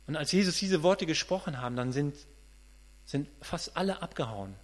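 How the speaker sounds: ordinary talk, nothing marked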